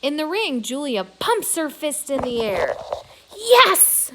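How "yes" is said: high, loud triumphant cry; yelling with joy; excited cry. A narrator's reading. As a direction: shout it out in triumph. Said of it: "she cries".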